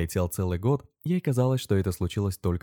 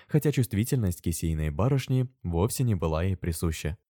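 ordinary delivery

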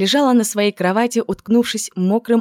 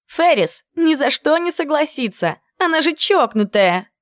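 put-on much higher, drawn-out girlish whine; whiny drawl; 9 semitones higher than usual. Clover protesting to Jen, high and drawling. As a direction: draw it out in protest. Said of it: "протянула Кловер".

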